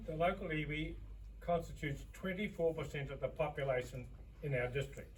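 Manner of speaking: spoken away from the microphone, faint and distant; too far from the mic. Real, not acted. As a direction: stand back from the mic as you say it. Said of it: "Closer to the microphone".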